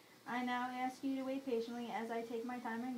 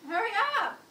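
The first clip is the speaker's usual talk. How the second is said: calling out; 11 semitones higher, 11 dB louder than usual. Real, not acted. Calling to mother impatiently.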